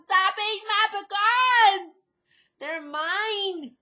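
loud, high, hammed-up yell guarding her snacks; mock outburst. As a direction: shout it at the husband as if he stole the treat. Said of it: "and it's like".

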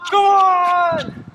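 loud, high excited yell. Real, not acted. Cheering during a court drill rally, excited yell. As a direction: shout it out, way too excited. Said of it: "I'm way too excited for that".

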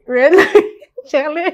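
giggling at a flubbed twister; laughing after tripping up. Real, not acted. Trips over the tongue twister.

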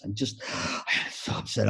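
soft, slow sighing imitation; upset, shaken dad. Band dad confessing nerves to the helper, upset. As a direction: sigh it out as a flustered father. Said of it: "he was just shaking".

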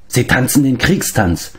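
normal talking voice

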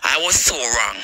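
mocking the apology she never gave. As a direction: imitate her apologising, playing it meek. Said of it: "come from them and say".

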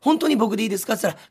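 normal talking voice

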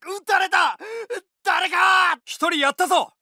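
high, pained battlefield shout; urgent call across the field; shouting in battle. Acted. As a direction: yell out as the bullet hits. Soldier hit, calling for help.

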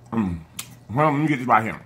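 chewing while talking; speaking between bites; mouth full of salad. Real, not acted. Seen 0: salad on fork, bite taken at 2.3, lips closed chewing after.

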